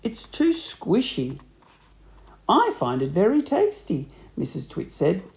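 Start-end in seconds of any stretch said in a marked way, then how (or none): 0.0-1.4 slow, higher-pitched complaint through food; mouth full. A narrator's reading. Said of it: "talking with his mouth full". 2.5-4.1 higher, lilting wifely reply; a hint of a sly wife, pitched up; lightly voiced wife. A narrator's reading. Wife answering husband's complaint.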